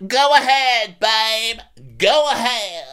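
very high, loud mock impression; Erica. Erica telling Kyle to go ahead, high loud mock voice. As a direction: push it up into a squealed, hammed-up voice. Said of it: "And Erica goes".

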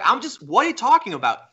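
high, incredulous question; retold baffled protest. Acted baffled reply in the quarrel.

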